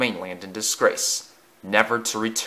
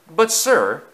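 objecting; 6 semitones higher, 5 dB louder than usual. Acted. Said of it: "objected Alec Greedy".

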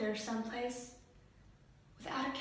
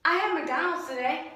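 high pitched; 5 semitones higher, 5 dB louder than usual. Shown as a demonstration. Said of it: "what was the high for today".

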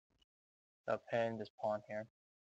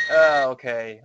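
loud exclamation; 15 dB louder, slower than usual. Real, not acted. Sudden relief in lost game.

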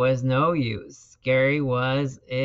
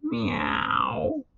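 high, rising cat-like meow; small pet's questioning mew; snail's meow; 6 semitones higher than usual. A narrator's reading. Gary the snail answers.

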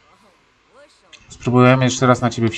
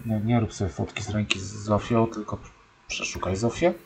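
ordinary talk, nothing marked

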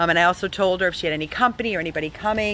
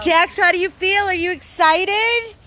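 pet talk; 11 semitones higher, 4 dB louder than usual. Real, not acted. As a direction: gush at the dog. Asking the dog.

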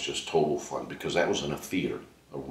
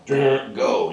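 short louder call, a bit higher; mock cue call; 6 semitones higher, 7 dB louder than usual. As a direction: bark the cue like a stage director. Director's cue imitated.

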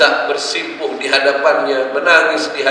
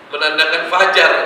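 ordinary talk, nothing marked

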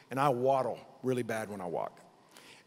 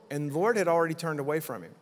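normal talking voice

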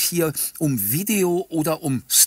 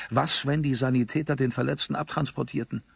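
plain reading voice

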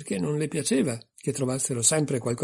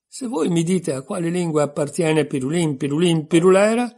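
raised, louder triumphant exclamation; 5 semitones higher, 6 dB louder than usual. A narrator's reading. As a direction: announce your way out victoriously, high and loud. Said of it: "esclamò trionfante". (esclamazione trionfante a voce alta)

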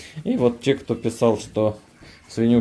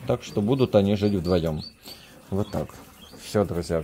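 normal talking voice

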